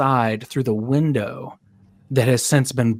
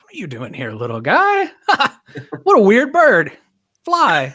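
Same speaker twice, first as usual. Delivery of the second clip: higher, louder exclamations with a laugh; amused passer-by; 9 semitones higher, 5 dB louder than usual. Acted. Passer-by scooping up a stray penguin, amused and exclaiming. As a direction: laugh in amused surprise at an odd creature. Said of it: "somebody scoops him up and is like".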